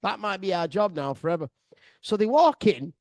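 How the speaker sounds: ordinary talk, nothing marked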